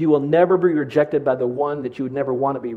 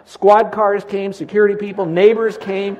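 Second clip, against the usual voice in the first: rising excitement; 4 semitones higher, 6 dB louder than usual. Retold or imitated. Alarm chaos, neighbors accusing.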